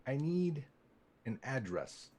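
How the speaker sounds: usual speaking voice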